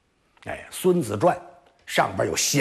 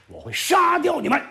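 high, forceful general's warning; stern threat. Acted. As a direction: threaten them like a strict general. Sun Wu threatens maids. (孙武厉声警告要杀人)

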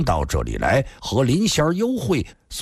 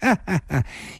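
short, high-pitched acted chuckle; woman's laugh; 6 semitones higher than usual. A narrator's reading. Woman amused at friends avoiding each other, light laugh. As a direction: give a light laugh in three little bursts. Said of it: "那个女子也笑了".

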